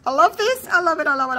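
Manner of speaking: high, eager gushing; gushing delight. Real, not acted. Crafter adoring the piece being made, gushing with delight. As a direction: gush it out in a rapid, repeated rush.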